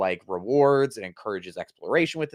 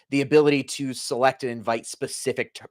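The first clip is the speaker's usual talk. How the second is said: ordinary talk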